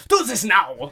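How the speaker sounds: put-on bossy command in a light German accent. Cousin Dominic ordering workers, mock German accent. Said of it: "all in the German accent".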